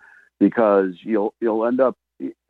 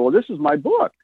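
gushing proud pitch; 12 semitones higher than usual. Retold or imitated. Author showing his book cover to a couple, high and gushing.

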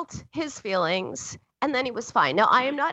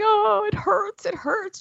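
high, drawn-out mock wail; put-on tearful child voice; imitated crying child; 10 semitones higher, slower than usual. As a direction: wail like a little girl with a hurt knee. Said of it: "she was crying and pointing".

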